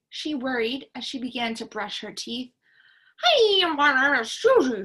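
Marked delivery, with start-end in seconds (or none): 3.2-4.8 garbled toothpaste words; mouth full of toothpaste. Acted. Girl brushing her teeth at the mirror, mouth full. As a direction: talk through a mouthful of foam. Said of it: "mouth full of toothpaste".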